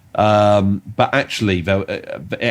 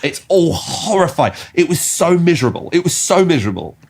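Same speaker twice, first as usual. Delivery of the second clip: higher, laughing dismay; mock horror; 10 semitones higher, 3 dB louder than usual. Real, not acted. Reacting to grim children's stories, high mock dismay. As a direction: wail it in amused despair.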